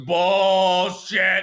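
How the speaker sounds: huge high-pitched drawn-out holler; imitated yelling. Coworker answering back in a restaurant with no music, hollering. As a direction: yell it as loud as it goes, imitating. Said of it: "he'd be yelling".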